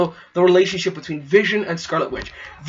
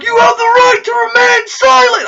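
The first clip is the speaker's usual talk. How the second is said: much higher, louder mock-official cop line; cocky Spider-Man; 7 dB louder than usual. Spider-Man to Falcon, mock arrest in a high, loud voice. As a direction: squeak it out like a kid playing cop. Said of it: "Spider-Man's like".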